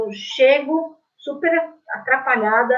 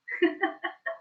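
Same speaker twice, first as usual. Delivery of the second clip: soft laugh; 8 semitones higher, 8 dB quieter than usual. Real, not acted. Laughing at not knowing about likes.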